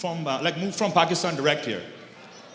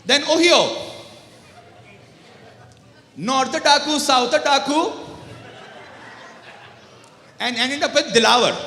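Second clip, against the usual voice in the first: put-on slow, loud, high Indian-accented teacher; hardcore Indian accent; 5 semitones higher, 4 dB louder than usual. Rajasthani history teacher listing states in a thick Indian accent. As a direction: say "Utta", "Ohio", "North Otaku", "Dilawar" in a heavy Indian accent. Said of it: "hardcore Indian accent".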